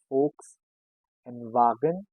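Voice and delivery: Volks as "folks", Wagen as "VAH-gen"; German pronunciation. Shown as a demonstration. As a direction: split Volks and Wagen apart. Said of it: "V sounds as F".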